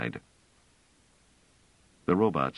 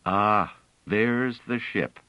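a slow, triumphant "there's the ship"; mildly triumphant discovery; 4 semitones higher, slower than usual. A narrator's reading. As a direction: announce the find with pride and relief.

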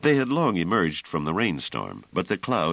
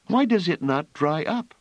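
put-on frightened child; 13 semitones higher, 4 dB louder than usual. A narrator's reading. Frightened child asks Bliss.

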